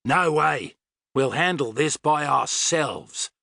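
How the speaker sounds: forceful Australian refusal; savage. A narrator's reading. Tony cutting off Astrid on the radio, savage. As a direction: refuse savagely, stretching the words. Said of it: "he said savagely".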